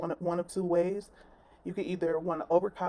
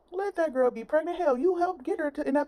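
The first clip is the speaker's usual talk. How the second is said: exasperated; 9 semitones higher than usual. Real, not acted. Repeated with rising exasperation.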